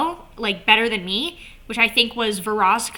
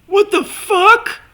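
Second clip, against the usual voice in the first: high, louder, slow "What the fuck?" rising; shocked high version; 9 semitones higher, 7 dB louder, slower than usual. Shown as a demonstration. Fan demoing the bigger reaction, high loud shocked. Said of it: "changed their facial expressions to".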